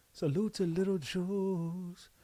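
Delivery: slow, soft coo to a puppy; tender puppy talk. Real, not acted. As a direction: croon it gently to the little dog. Talking softly to his puppy.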